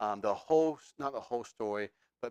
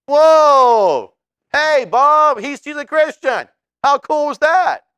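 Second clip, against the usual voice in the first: very high, loud, astonished exclamation; hammed-up wide-eyed amazement; mock surprise; 14 semitones higher, 15 dB louder, slower than usual.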